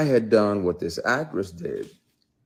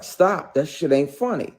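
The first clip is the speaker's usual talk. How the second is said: firm warning; 8 semitones higher, 5 dB louder than usual. Retold or imitated. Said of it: "I was like".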